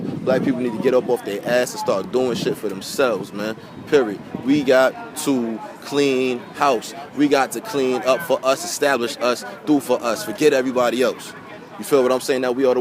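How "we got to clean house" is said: slow emphatic. Acted. Preaching a call to action.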